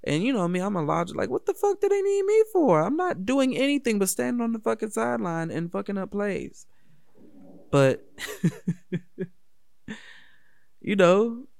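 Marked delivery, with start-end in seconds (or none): 1.3-6.7 high, loud incredulous protest; exasperated disbelief. Retold or imitated. Younger self questioning why the team needs him, incredulous. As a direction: squeal it in mock outrage at being needed. Acting out his own disbelief.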